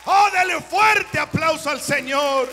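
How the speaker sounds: loud, high shouted exhortation; preaching at full force. Acted. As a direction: rouse the congregation with a shout. (predicando a gritos con fuerza)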